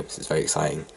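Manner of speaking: usual speaking voice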